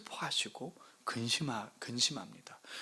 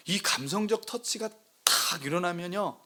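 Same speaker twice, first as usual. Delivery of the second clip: ordinary delivery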